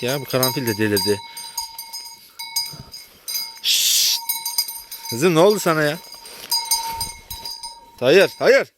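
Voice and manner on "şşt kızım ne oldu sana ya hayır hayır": raised, higher voice scolding a cow; scolding shout. Real, not acted. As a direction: call her off firmly. Scolding agitated cow Karanfil.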